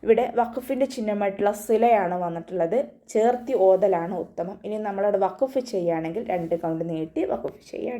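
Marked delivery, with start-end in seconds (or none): none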